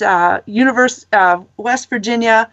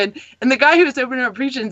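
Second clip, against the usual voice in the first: ordinary delivery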